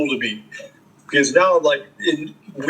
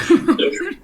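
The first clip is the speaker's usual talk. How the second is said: high laugh; 13 semitones higher than usual. Real, not acted. Laughs at own story.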